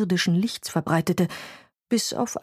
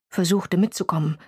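usual speaking voice

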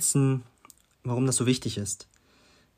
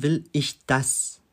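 slow, spaced question ending on an emphatic "das"; stress on das; much slower than usual. Shown as a demonstration. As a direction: separate each word and land on "das". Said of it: "Dritte Frage".

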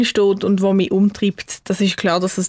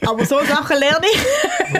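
laughing; 7 semitones higher than usual. Real, not acted. Embarrassed after loud vocal demo.

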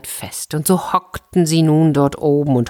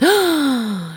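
sharp high gasp of fright; startled gasp; 10 semitones higher than usual. A narrator's reading. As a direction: suck in air in shock. Robbers startled by falling door.

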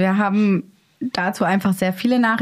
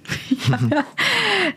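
amused laugh; 10 semitones higher than usual. Real, not acted. Laughs at own joke.

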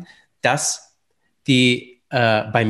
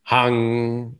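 pinching nose; 3 semitones lower than usual. Shown as a demonstration. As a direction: Hang mit zugehaltener Nase. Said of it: "hältst dir mal die Nase zu". (mit zugehaltener Nase)

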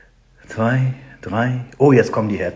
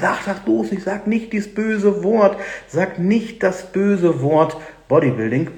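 imitating marketing agency; 7 semitones higher than usual. Coach mimicking his agency banning a word, high mock-alarmed warning. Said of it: "Meine Marketingagentur sagt".

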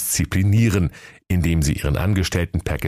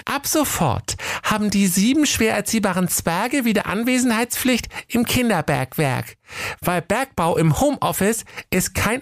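very high, prim voice reading the decree. Acted. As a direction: recite the stern letter in a girlish voice. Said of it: "las laut vor".

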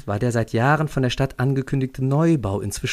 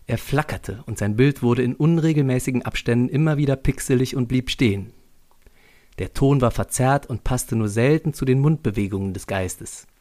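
ordinary delivery

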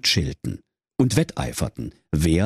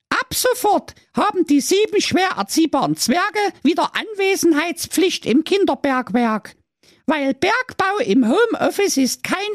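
pompous decree read aloud. Acted. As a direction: read it out like a royal herald. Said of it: "Das schöne Mädchen las laut vor".

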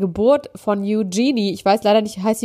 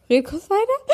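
high strained call before a sneeze; about to sneeze; 12 semitones higher, slower than usual. Real, not acted. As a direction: blurt it out, a sneeze coming. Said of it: "gleich muss ich ganz doll niesen".

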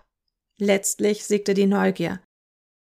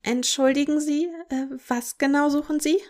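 higher, timid squeaky voice; 6 semitones higher than usual. A narrator's reading. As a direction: squeak it out nervously. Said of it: "mit piepsiger Stimme".

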